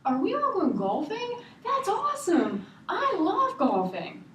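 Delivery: put-on thrilled kid calling out, high and loud; high, drawn-out, over-the-moon squeal of words; Erin. Erin calls from the living room about golfing, imitated as loud excitement. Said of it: "you hear Erin from the living room going".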